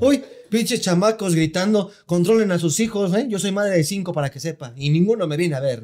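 mock cranky señora; grumpy old lady. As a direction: scold the noisy kids like a bitter old mother.

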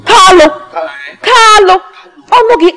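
loud high call of Kalu. Acted. Woman calling Kalu loudly across the house. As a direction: shout his name across the house.

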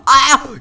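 high, loud "ah"; sharp cry of alarm; startled yell. Real, not acted. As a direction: yelp as if suddenly hit. Hit back in game.

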